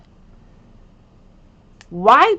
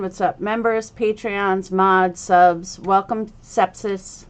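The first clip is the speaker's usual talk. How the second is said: ordinary delivery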